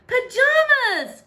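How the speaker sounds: high, loud, slow exclamation; exclaiming. Acted. Prince crying out at the princess's pajamas, excited. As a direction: cry it out in delight. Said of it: "cried Pugly".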